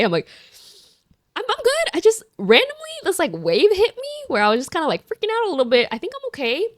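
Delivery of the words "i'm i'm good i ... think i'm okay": high, falsely casual reassurance; feigned okayness. Retold or imitated. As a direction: play it off in a high little voice. Retold brushing off friend's concern.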